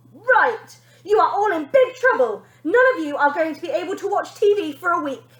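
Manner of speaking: high, loud angry shout. A narrator's reading. Girl shouting threats at her hiding family, high and loud. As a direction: yell the punishment at them. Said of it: "shouted the girl".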